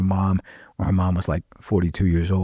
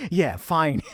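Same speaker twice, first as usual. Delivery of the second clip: laughing through a shrugging yes; laughing reply; 7 semitones higher than usual. Retold or imitated. Friend brushing off the God part, laughing it off. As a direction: toss it off with a laugh.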